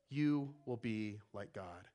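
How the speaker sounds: You drawn out slowly, low and hushed; stress on You. Shown as a demonstration. Preacher repeats the lie to the congregation, slow and low. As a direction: linger on You will be like God. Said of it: "this is the greatest lie of sin".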